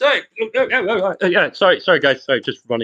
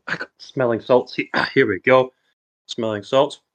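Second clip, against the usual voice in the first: normal talking voice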